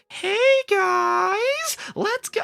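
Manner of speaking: very high, squeaky cartoon-character voice; cartoony voice acting. Mocking Star vs. the Forces of Evil's acting, very high cartoon voice. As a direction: overdo it like a kids' show voice actor. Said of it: "'cause it sounds like voice acting".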